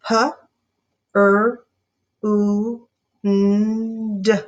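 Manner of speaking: P, r, u, n, d each apart; five sounds of pruned spaced. Shown as a demonstration. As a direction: segment pruned slowly. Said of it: "Say it sound by sound".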